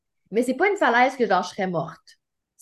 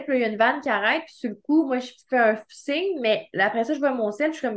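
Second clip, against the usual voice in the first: normal talking voice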